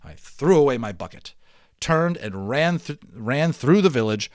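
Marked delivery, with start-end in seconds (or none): none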